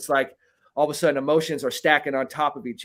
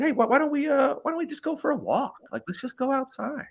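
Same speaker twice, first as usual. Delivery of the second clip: higher, soft coaxing voice; gentle invitation; 12 semitones higher, 3 dB quieter than usual. Retold or imitated. Inviting the big guy for a walk, higher and gentle. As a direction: lightly coax him outside.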